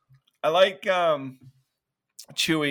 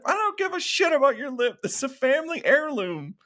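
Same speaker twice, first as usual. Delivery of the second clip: high, indignant retort; mock outraged; 12 semitones higher than usual. [name] scolds injured Chewie about the family chair, outraged. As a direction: imitate a furious scolding, pitched far up.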